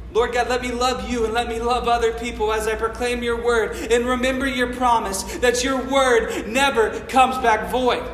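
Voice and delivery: normal talking voice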